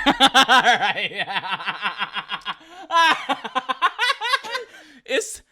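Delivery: long, high-pitched real laughing fit; helpless, drawn-out laughter; cracking up. Real, not acted. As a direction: burst out laughing loudly around the words. Cracking up at her answer.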